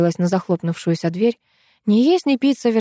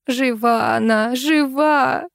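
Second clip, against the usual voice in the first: slow Жива rising to a high жива; insist, repeating it; insistent repetition; 6 semitones higher, much slower than usual. A narrator's reading. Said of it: "твердила Ирина".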